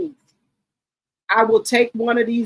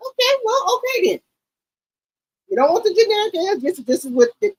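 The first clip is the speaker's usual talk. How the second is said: much higher, fed-up voice; high exasperated reply; 11 semitones higher than usual. Retold or imitated. Daughter mimicking her exasperated reply to mama, much higher. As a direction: throw up your hands in a high put-on tone.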